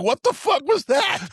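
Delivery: high-pitched mock shout of disbelief; shocked yell. Podcaster acting Ogre's reaction to Booger, high outraged yell.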